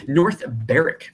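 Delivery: North Berwick said BERR-ik, silent w; local pronunciation. Shown as a demonstration. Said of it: "If anyone says North Berwick".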